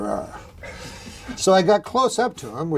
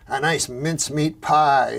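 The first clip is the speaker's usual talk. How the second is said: ordinary talk, nothing marked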